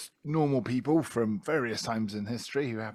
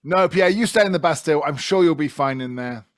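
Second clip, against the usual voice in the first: mocking jailer shout; 3 semitones higher, 8 dB louder than usual. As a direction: bellow a mock send-off through the bars. Player voicing jailer to Pierre.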